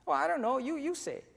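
put-on very high childish voice; little girl. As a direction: answer like an unsure small girl. Little girl answers him.

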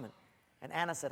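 usual speaking voice

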